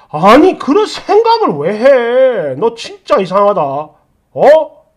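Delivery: drawn-out, incredulous scolding; angry belittling reproach; exasperated husband. Acted. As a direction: stretch it out, fed up. Acted husband berating wife.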